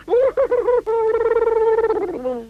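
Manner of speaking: long high whimpering wail; a squeaky cry of fright; frightened squeal. A narrator's reading. Mister Jelly panics.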